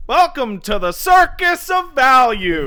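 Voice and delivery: slow booming carnival clown. Retold or imitated. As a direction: announce it like a creepy circus clown. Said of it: "thinking of that clown voice from BioShock".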